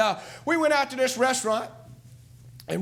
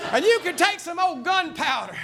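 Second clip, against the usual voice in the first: preaching at full force; 4 semitones higher than usual. Acted. Preacher rousing the church about real preachers, full-force preaching. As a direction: thunder it out to the church like a revival preacher.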